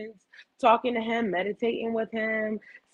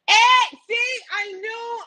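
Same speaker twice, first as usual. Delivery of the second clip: excited; 13 semitones higher, 5 dB louder, slower than usual. Real, not acted. Spots her brother in chat.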